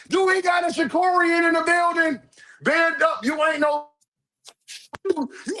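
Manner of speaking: high-pitched mock announcement; high call-out. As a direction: call it out high to the whole room. Hyped call-out to the stream.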